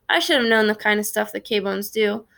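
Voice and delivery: plain reading voice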